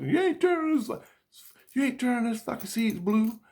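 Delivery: high-pitched imitation of an angry wife; mock wife. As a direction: squeak it out as the furious wife. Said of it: "my wife said".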